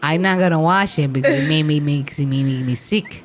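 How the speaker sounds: put-on low, lightly done Spanish accent of a mom; mom's Spanish accent. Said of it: "She puts it in Spanish accent".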